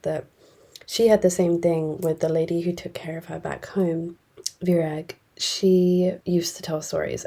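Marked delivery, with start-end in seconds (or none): none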